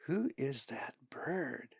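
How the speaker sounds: slow, breathless whisper; very quietly. A narrator's reading. Colin asking Zack about the big raven, in a slow hushed whisper. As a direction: whisper it so nobody hears. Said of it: "very quietly".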